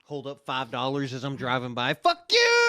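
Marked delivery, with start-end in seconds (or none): 1.9-2.7 high, loud drive-by shout; mock yell. Driver taunting the homeless with five dollars, mock yell. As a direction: holler it out the car window, drawn out. Yelling at homeless while driving by.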